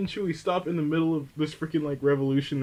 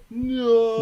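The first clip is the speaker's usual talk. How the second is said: high, loud drawn-out "no" cry; mock scream; 9 semitones higher, 7 dB louder than usual. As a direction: wail it out like an overdone movie scream. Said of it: "sit there and scream".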